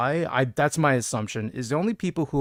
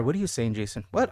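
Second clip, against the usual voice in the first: ordinary delivery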